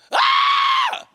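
very high loud mock scream of fright; terrified scream. Disciples seeing Jesus, screaming in fear. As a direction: shriek like you've seen a ghost. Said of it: "and they're like".

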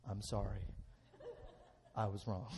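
humble apology. Retold or imitated. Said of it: "add these words to your vocabulary".

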